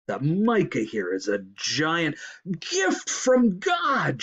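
rising into a high, drawn-out proclamation; shout. A narrator's reading. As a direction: build to a slow, ringing cry. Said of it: "shouted Hipswitch".

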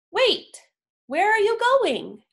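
high, loud call after someone running off; surprised call to a departing friend; calling after him. Acted. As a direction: shout after him as he dashes away. Scribble Spot runs off suddenly.

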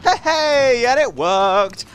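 high, loud cheer; delighted cheer. Real, not acted. Player whose hiding trick worked, delighted cheer. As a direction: celebrate a plan that worked.

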